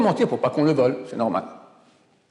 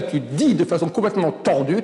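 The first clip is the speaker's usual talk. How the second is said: usual speaking voice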